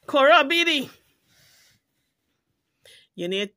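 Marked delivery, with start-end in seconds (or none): 0.1-0.9 a sharp, loud call of Cora Beatty; calling out a name. Real, not acted. Vlogger calling out the mother who allowed it, loud call. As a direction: call her out, high and loud.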